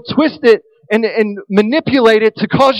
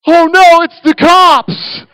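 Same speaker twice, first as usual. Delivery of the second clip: high, loud panicked yell, slowed on the last words; a teen at the front of the pack yelling a warning to his friends; yelling in alarm; 7 semitones higher, 6 dB louder, slower than usual. Retold or imitated. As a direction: shout it like the cops just showed up. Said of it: "yells out".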